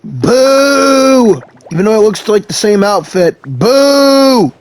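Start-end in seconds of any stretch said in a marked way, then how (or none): 0.0-1.4 mock booing. Real, not acted. Booing the same old outfit. 3.4-4.5 mock booing. Real, not acted. Booing the same old outfit.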